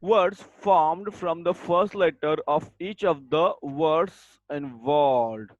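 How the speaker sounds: dictation. Shown as a demonstration. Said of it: "dictating definition".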